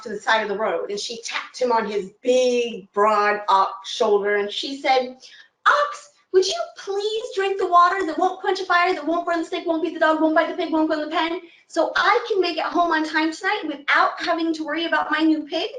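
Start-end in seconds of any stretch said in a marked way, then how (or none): 5.7-15.7 put-on high old woman voice asking a favor; old woman pleading. Old woman asks the dog to bite her pig.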